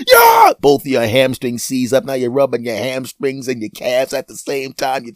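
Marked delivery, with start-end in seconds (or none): 0.1-0.5 triumphant yell. Acted. Triumphant cry.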